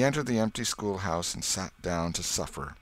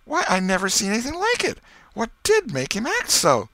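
put-on high, loud bewildered old aunt; an old lady baffled and demanding; Aunt Polly; 14 semitones higher, 7 dB louder than usual. A narrator's reading. Old aunt asks the boy about the cat, high and bewildered.

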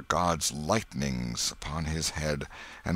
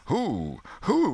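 startled questions; 10 semitones higher than usual. A narrator's reading. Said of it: "from twenty voices".

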